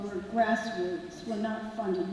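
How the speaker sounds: usual speaking voice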